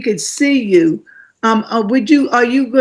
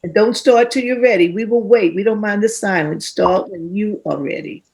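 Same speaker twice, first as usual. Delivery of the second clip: calm reassurance. Real, not acted. Host calming a nervous young poet before she starts, gently reassuring. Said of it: "host calming nervous poet".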